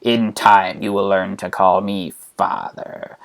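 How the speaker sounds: soft, low, sinister Emperor; Palpy. Acted. Emperor promising to train his captive, low soft Palpy voice. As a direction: draw it out low, savouring the menace. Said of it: "And he says".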